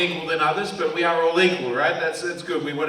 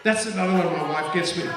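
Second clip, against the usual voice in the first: usual speaking voice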